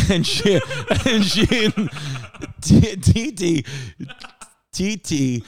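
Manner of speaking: giggling through the words; breaking up with laughter mid-sentence; laughing. Real, not acted. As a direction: laugh while you try to get her name out. Laughing while naming T T.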